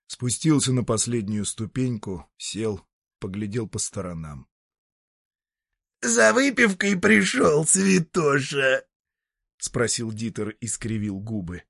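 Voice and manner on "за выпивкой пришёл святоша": high, creaky devil voice; a creaky, mocking old devil. Devil Dieter sneering.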